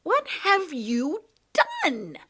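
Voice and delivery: high, loud, slow angry demand; tangled girl furious at the cat. Acted. Trapped Lindy demands answers from the cat, angry. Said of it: "she said in an angry voice".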